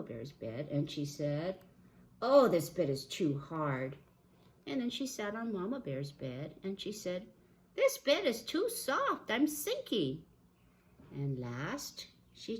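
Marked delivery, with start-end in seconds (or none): 2.2-4.0 lightly voiced complaint, louder; Goldilocks. Goldilocks on Papa Bear's bed, complaining. 7.8-10.2 put-on high, loud complaining girl; Goldilocks. Goldilocks sinking into Mama Bear's bed, complaining.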